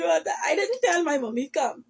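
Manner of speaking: usual speaking voice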